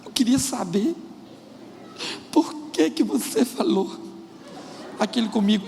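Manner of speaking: hammed-up crying voice, broken by long pauses; mock tearful wife. Wife asks her husband after the row, mock weeping. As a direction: draw it out haltingly as if choking back sobs. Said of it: "ela começa a chorar".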